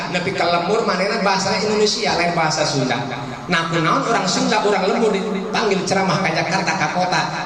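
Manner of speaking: normal talking voice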